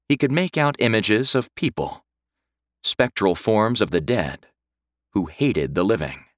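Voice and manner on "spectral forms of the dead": plain reading voice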